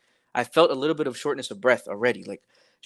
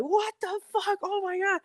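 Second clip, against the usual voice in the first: very high falsetto disbelief; imitating yourself losing it, pitch sky-high; retold freak-out; 5 dB quieter than usual. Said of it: "So I'm freaking out".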